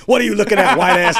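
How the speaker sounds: loud, high, laughing yell; hammed-up challenge to your own reflection; mock confrontational shout.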